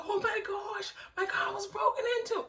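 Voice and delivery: high, wailing mock distress; high-pitched acted dismay; retold tearful shock. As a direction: reenact a shocked cry at a break-in. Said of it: "And I was crying".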